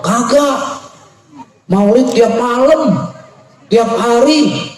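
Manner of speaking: high-pitched, drawn-out emphatic denial; bantahan bersemangat. Acted. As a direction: exclaim it high, stretching the words. Penjual membantah dengan semangat. (membantah dengan semangat, nada tinggi)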